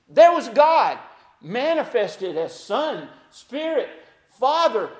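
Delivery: loud and high, proclaiming; full-force preaching. Acted. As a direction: lift the whole room with it.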